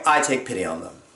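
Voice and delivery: ordinary delivery, nothing marked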